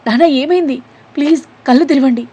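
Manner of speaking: high, loud desperate pleading; frantic shout. A narrator's reading. Daughter frantically calls her collapsed father. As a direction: beg him to open his eyes, loud and high. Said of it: "ఇద్దరూ గట్టిగా అరుస్తూ".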